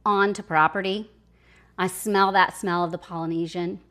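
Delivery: ordinary talk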